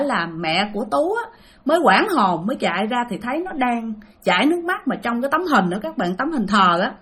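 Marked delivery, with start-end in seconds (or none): none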